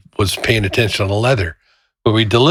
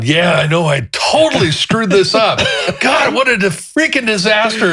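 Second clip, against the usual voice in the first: flustered apology; 10 semitones higher than usual. Retold or imitated. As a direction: sputter it out in panic. Agreeing with angry client.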